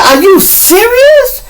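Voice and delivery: high, drawn-out incredulous cry; shocked disbelief. Retold or imitated. Reacting to his friend's mother's news, shocked disbelief. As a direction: stretch it out in a loud, high voice of disbelief.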